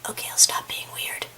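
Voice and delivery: very soft sheepish whisper; whispered aside. Real, not acted. Reader excuses own silliness to viewers, whispered aside. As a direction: drop to a tiny whisper.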